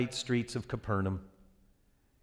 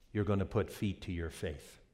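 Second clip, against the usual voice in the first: normal talking voice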